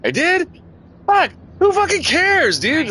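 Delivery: high-pitched, incredulous retold comeback; exasperated dismissal. Retold dismissive reply to coworker.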